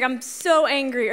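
so hit hard with a falling tune; stress on so. Retold or imitated. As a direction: punch the word so. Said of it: "punched her hand to just really emphasize it".

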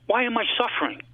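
high, pained cry to God; cried out. Retold or imitated. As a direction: cry the question out, lifted high. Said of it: "I cried".

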